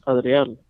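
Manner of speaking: Spanish tap and vowels in "Adriel"; Spanish pronunciation. Retold or imitated. Friend first reading her name, "Adriel" in Spanish. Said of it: "I say it in Spanish".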